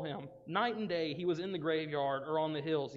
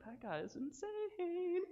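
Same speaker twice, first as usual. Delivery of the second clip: scared onlooker; 13 semitones higher, 6 dB quieter, slower than usual. Retold or imitated. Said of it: "people are gonna get scared".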